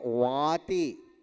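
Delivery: वाति with a clear v and long aa; careful pronunciation. Shown as a demonstration. Teacher showing listeners the given word, careful pronunciation. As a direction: draw out वाति, opening on a v sound. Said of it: "क्या उच्चारण कर रहे हैं".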